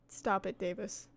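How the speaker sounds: ordinary talk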